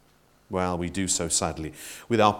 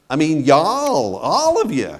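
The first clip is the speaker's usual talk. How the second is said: Southern y'all; 5 semitones higher, 5 dB louder than usual. Shown as a demonstration. Preacher explaining Jesus's plural you in a Southern accent. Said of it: "an American Southern accent type of you all".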